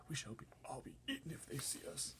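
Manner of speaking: high, hushed and worried; fearful whisper. Acted. Earthworm fearing the cloudmen, whispered. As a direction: whisper it, scared of being seen. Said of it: "the earthworm whispered".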